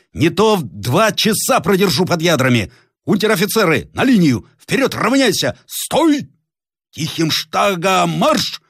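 very high shouted commands; thunderous shout. Acted. Captain to his ranks, very high shouted orders. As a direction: keep roaring the orders. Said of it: "закричал громовым голосом".

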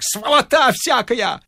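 put-on a drunken janitor bawling at the neighbours; howling janitor. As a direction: scream it across the whole yard. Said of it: "вопил дворник".

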